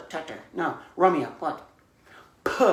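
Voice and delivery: ordinary talk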